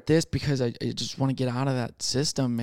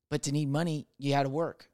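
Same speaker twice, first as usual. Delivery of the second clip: ordinary talk, nothing marked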